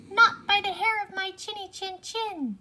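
high, loud defiant pig. A narrator's reading. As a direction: pig refusing loudly. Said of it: "and he said".

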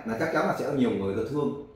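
ordinary talk

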